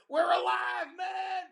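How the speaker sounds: very high, loud triumphant yell; excited band member shout. Retold or imitated. Band member yelling to the crowd, very high loud whoop. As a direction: shout it like a rocker climbing on stage. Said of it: "band members from Skinner goes".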